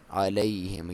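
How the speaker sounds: usual speaking voice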